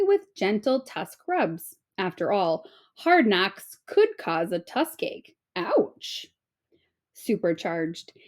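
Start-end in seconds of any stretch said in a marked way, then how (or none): 5.6-6.3 mock ouch. Joke about a tusk ache.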